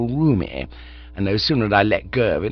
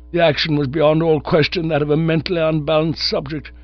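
put-on higher, stiff voice of a pompous old nerve specialist; pompous old gentleman; 5 semitones higher than usual. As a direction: lecture like a self-important old doctor. Sir Roderick's long speech.

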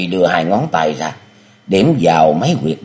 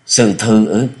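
higher, alarmed; startled cry; 4 semitones higher, slower than usual. Acted. The hero learns she is his senior sister, startled. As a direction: blurt it out in shock. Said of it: "kinh hãi kêu lên".

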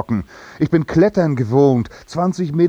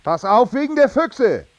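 high, called-out warning; calling a warning; 8 semitones higher than usual. Acted. Man warning departing wife about foxes, calling out. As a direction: call after someone, voice raised. Calls warning after someone leaving.

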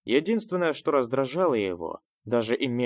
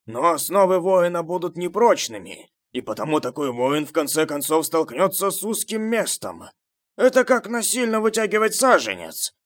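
raised, insistent voice, pitched high; emphatic henchman; 6 semitones higher, 6 dB louder than usual. A narrator's reading. Henchman warning the crowd about shortcuts, loud and insistent. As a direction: press the argument loudly on listeners.

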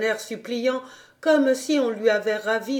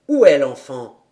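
low, firm gentleman asking; voix d'homme grave; 7 semitones lower, 9 dB louder than usual. A narrator's reading. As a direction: ask in a deep fatherly voice. Monsieur demande l'enfant, voix grave. (voix grave et ferme du monsieur)

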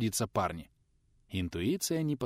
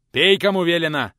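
loud gruff growl; 6 semitones higher, 10 dB louder than usual. A narrator's reading. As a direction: growl the order at him. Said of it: "рыкнул в ответ учитель". (рычит)

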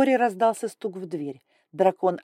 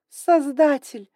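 slow, higher, loud exasperation; exasperated; 8 semitones higher, 8 dB louder, much slower than usual. Acted. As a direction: groan it to the heavens. Grai exasperated.

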